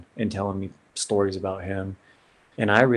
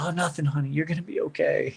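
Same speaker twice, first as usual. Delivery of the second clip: mom brushing it off; 7 semitones higher, 3 dB quieter than usual. Retold or imitated. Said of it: "And she's".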